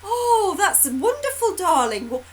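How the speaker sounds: put-on high sing-song praise to a child; mock delighted parent. Parents hiding dismay from their child, high fake delight. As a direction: gush over the drawing, voice way up. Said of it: "and go".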